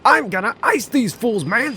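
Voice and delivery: very loud, high hammy thug; overacted anime dub. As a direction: roar the threat like a cartoon gangster. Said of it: "said the heavy-set fella".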